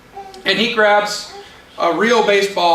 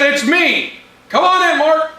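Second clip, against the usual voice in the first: high, loud, cheerful holler; calling through door; 9 semitones higher, 5 dB louder than usual. Acted. Old friends call through the front door, hollering.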